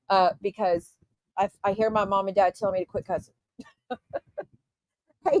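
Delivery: normal talking voice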